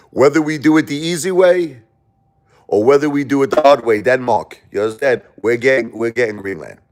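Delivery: high, loud put-on Trump voice with a laugh; imitating Trump. Warning Denmark about Greenland, hammed-up high Trump voice. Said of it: "President Trump made it absolutely clear".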